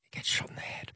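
hushed, whispered aside; whispered spoiler. Real, not acted. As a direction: slip it out under your breath.

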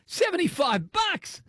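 high, slow laughing shout of disbelief; stunned by the price, laughs it out high. Real, not acted. Shocked at lure price.

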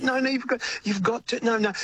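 high, insistent mock scolding; severe objection. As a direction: cut in like a stern friend refusing to accept it. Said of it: "he was really severe on it".

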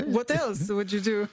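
put-on playful French-accented echo. Coach echoing the Parisian act, French accent. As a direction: tease back in a French accent. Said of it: "the center of placement of his accent".

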